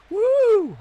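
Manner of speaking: shrill high-pitched woo shout; high falsetto woo. Pretend. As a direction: belt a high woo like the entrance theme. Entrance woo.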